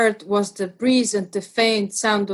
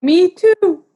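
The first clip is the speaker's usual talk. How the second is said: put-on much higher, louder little child squeak; baby kangaroo voice; 9 semitones higher, 6 dB louder than usual. Young kangaroo answering from the pouch, tiny childlike squeak. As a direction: pipe up like a tiny cheeky baby.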